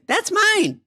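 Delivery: put-on very high, squeaky "mine" of a kid; childish claim. Manager claiming the giant pretzel, very high and childish. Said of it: "and he goes".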